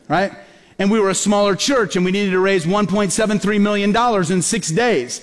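ordinary talk, nothing marked

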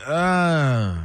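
drawn-out "ugh" of mock disgust; groan of distaste. Host voicing fans souring on the player, drawn-out disgusted groan. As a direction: let out a long, put-off groan. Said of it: "it starts to become".

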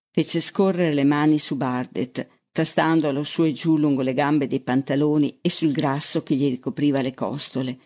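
plain reading voice

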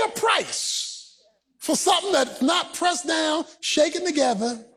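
forceful, high-pitched sermon delivery; impassioned preacher driving the point home; preaching. Real, not acted. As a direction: preach it hard to the congregation, stretching key phrases.

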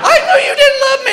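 very high, wailing fake sob of a wife; hammed-up teary wife, whining high; wife wailing at her husband in the line, mock sobbing; mock crying wife. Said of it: "Here comes the tears".

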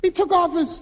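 ordinary talk

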